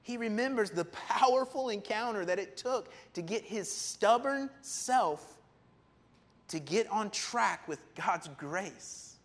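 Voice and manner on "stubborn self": drawn out. Real, not acted. Slowed for emphasis.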